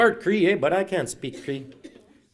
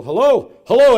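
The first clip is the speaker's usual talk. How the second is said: high, very loud phone hello; yelling into speakerphone; 10 semitones higher, 15 dB louder than usual. Retold or imitated. Elder on speakerphone yelling real close to the phone, loud. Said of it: "they yell real close to the phone".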